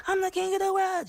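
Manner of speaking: high-pitched mock crowing; imitated obnoxious boasting. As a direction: mimic a show-off calling out way up high. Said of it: "like, really obnoxious".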